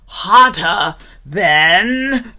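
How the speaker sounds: loud, slow, drawn-out build; building outburst. A narrator's reading. Narrator on gator's boiling temper, loud slow build. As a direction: swell each word toward an explosion.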